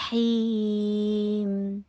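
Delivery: sustained demonstration recitation; slow recitation. Shown as a demonstration. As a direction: recite it with a stretched vowel. Teacher demonstrates slow Quran recitation.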